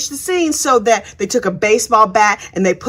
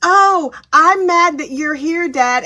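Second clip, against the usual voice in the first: higher, slow, hammed-up pouting; a hint of a bratty teen; mock teen anger; 5 semitones higher, slower than usual. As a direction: play a sulky teenager. Voicing angry teen daughter.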